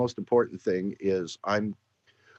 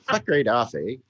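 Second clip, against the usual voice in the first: mock Canadian accent with a tacked-on eh; Canadian accent, pushing the eh; 3 semitones higher, 4 dB louder than usual. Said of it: "Say it again in your Canadian accent".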